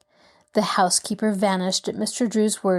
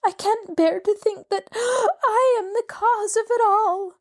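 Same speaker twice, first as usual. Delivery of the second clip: much higher, slow, breaking into a sob; a tearful widow breaking down before the lawyer; sobbing; 13 semitones higher than usual. A narrator's reading. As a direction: gasp and cry through the words. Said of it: "began to sob into her handkerchief".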